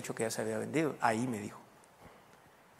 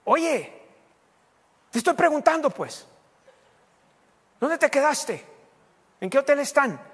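much higher, louder, insisting; impatient demand; 12 semitones higher, 7 dB louder than usual. Retold or imitated. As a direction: repeat the question, now fed up. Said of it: "Y luego dijo". (mamá Belén exige respuesta, aguda y muy fuerte)

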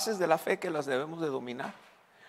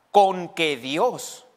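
slow, loud tempter's question; diablo tentando a Eva; 2 semitones higher, 5 dB louder, much slower than usual. Acted. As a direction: draw it out as the doubting devil. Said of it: "qué fue lo primero que le dijo a Eva". (diablo que duda, lento y fuerte)

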